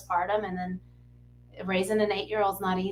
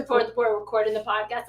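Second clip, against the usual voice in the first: ordinary delivery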